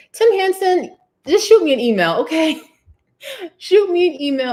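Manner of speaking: loud, high call to a viewer; high, loud playful summons. Real, not acted. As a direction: call him out across the room. Calling out a viewer by name.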